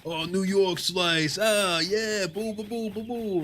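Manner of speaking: exaggerated New York accent babble; mock New Yorker. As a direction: imitate a New Yorker gushing over a slice. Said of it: "so he's gonna go like".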